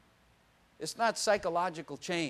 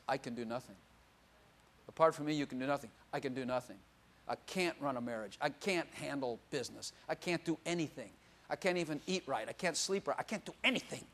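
quiet confession; 4 semitones lower, 7 dB quieter than usual. Retold or imitated. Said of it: "you realize".

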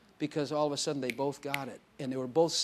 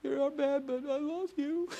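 high-pitched mock-weeping voice; tearful wife imitation; 12 semitones higher than usual. Preacher acting out the wife finally saying it, high tearful voice. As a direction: squeeze it out high, imitating her tears. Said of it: "she could finally say".

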